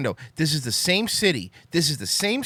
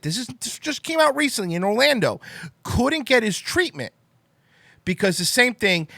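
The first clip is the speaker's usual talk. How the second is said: heated rant; 4 semitones higher than usual. Real, not acted. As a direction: let the outrage boil over, higher. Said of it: "I know I got heated".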